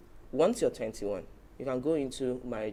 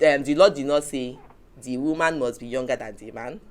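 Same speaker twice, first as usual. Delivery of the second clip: normal talking voice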